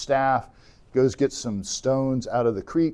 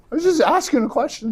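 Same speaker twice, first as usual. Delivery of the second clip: put-on whiny kid; 5 dB louder, faster than usual. David defends himself to brother.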